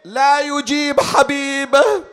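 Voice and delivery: high, loud, drawn-out lament; crying out. A narrator's reading. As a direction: cry out slowly to an unanswering beloved. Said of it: "ثم صاح".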